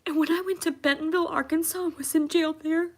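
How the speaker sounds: tearful. Real, not acted. Recalling the cruel jail guards, voice high with crying. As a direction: let the tears carry the memory.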